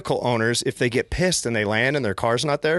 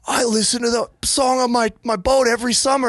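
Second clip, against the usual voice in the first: excited fan; 11 semitones higher, 3 dB louder than usual. Retold or imitated. Excited fan voiced in story.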